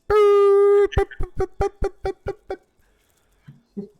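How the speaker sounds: put-on very high loud beeping boops like an old modem; dial-up modem noise. Recalling dial-up internet for a friend, high loud beeping modem imitation. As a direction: mimic the modem dialing in. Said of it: "the dial-up noise".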